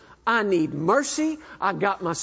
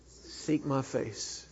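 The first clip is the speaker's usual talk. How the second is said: quiet, slow, gentle command; the Lord answering gently; soft voice of God; 6 semitones lower, 10 dB quieter, much slower than usual. Acted. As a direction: say it softly and slowly, like a calm answer. Voicing the Lord's answer.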